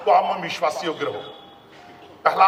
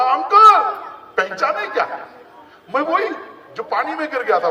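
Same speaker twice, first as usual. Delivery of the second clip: put-on high, loud child voice; child calling out; 9 semitones higher, 6 dB louder than usual. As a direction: call out to the uncle like an eager kid. Child calls out to uncle. (बच्चे की ऊँची, ज़ोरदार पुकार)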